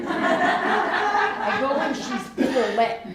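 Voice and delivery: slow, loud words through real laughter; laughing. Real, not acted. Husband laughs as he recalls her Paulette act, laughing loudly. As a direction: laugh it out, stretching each word.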